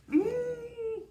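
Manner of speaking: ordinary delivery, nothing marked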